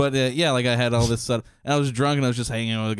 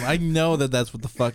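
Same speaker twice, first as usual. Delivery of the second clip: normal talking voice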